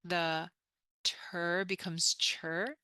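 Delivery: ordinary delivery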